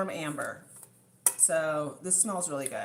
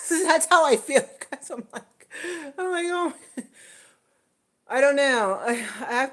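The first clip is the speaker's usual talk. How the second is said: laughing; 9 semitones higher, 9 dB louder than usual. Real, not acted. Laughing, flustered.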